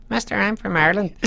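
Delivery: comically high, small Irishman; little boy-like voice beside a big man; tiny high voice. Retold or imitated. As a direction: squeak it up high, self-mocking. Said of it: "my voice sounded like".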